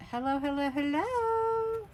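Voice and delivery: a playful greeting that soars up; cooing hello to a new package; sing-song hello. Real, not acted.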